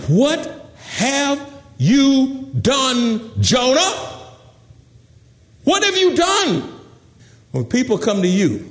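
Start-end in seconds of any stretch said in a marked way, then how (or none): 0.1-3.9 slow, high, word-by-word question; drawn-out demand. Acted. Terrified crew asks Jonah what he did, drawn-out demand. Crew's question from the verse, acted. 5.6-6.7 high, quicker outcry; urgent demand. Acted. Terrified crew asks Jonah again, urgent high demand. Crew's question repeated, acted.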